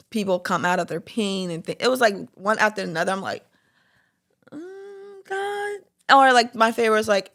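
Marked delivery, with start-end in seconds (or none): 4.5-5.8 high, soft mock whine; reluctant groan. To God about one painful sermon after another, high soft whine. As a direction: whimper it high and small to God.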